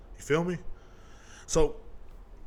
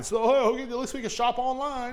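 much higher chirpy mock of a happy homebody; mock content shopper; 11 semitones higher than usual. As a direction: chirp it up high like a contented shut-in. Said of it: "They were like".

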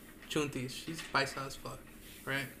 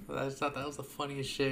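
normal talking voice

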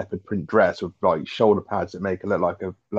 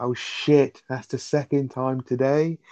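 higher, drawn-out imitation of dismay; Rodney's dismay; 5 semitones higher, much slower than usual. Co-host quoting Rodney's first line, high drawn-out mock dismay. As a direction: stretch it out high, mock exasperated. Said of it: "He's like".

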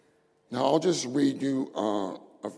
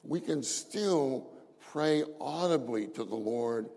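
usual speaking voice

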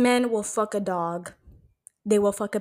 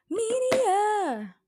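high sung-out segment title; announcer call; 13 semitones higher than usual. Pretend. Podcast host to listeners, sing-song announcer call.

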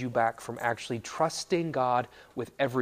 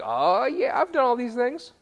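high, loud, breezy self-assurance; hammed-up bragging, pitched up; cocky young man; 5 semitones higher, 7 dB louder than usual. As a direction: play an overconfident young man waving off concern. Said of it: "the young man is like".

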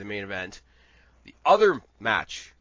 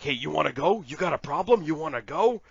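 higher-pitched, cocky picking of a fight; playful imitation of a wrestler itching to fight; mock challenge; 5 semitones higher, 4 dB louder than usual. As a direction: goad them with rising questions. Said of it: "asking the, all the interview girls in the back".

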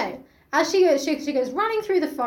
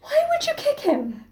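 mock outrage; 12 semitones higher than usual.

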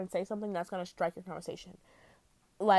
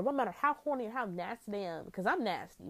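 normal talking voice

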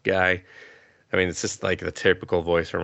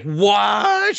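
high, loud stunned yelp; a hammed-up gasp of disbelief; mock shocked what; 13 semitones higher, 5 dB louder than usual. [name] reacting to her friend's answer with a mock shocked yelp.

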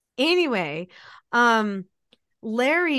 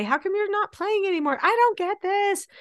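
imitating whiny child; 9 semitones higher than usual. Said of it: "Or I would say".

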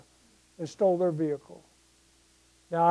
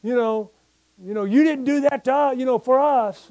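higher, louder, faster daughter's protest; mock daughter complaining; 6 semitones higher, 3 dB louder than usual. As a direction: play the grown daughter scolding her dad, pitched up. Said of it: "And they'll".

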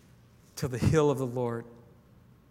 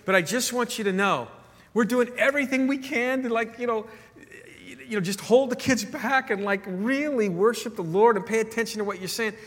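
higher, louder, pleading a little; earnest caller, voiced high; 8 semitones higher, 5 dB louder than usual. Caller to the pastor, high earnest plea. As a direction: lift your voice and plead your case. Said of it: "And he goes".

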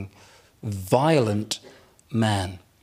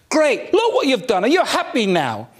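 mock angry wife; 8 semitones higher than usual. Wife snaps after smashed glass.

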